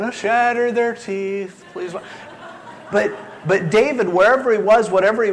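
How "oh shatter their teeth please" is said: higher, slow, sing-song mock prayer; a hint of a churchy intoning voice; mock pious prayer. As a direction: lightly ham up a pious plea, drawn out. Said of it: "most of us wouldn't sit here going".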